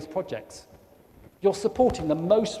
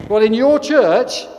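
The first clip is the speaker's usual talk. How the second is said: slow, loud and higher, leaning on your; teasing punchline; 6 semitones higher, 11 dB louder, slower than usual. Real, not acted. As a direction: drag out in your church for the joke. Punchline about the church clock.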